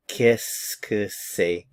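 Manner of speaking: Qu'est-ce que c'est stretched slow as kiss-kuh-say; slow French demo. Shown as a demonstration. Teacher models Qu'est-ce que c'est slowly. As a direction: spell out the sounds slowly. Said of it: "Listen to the pronunciation".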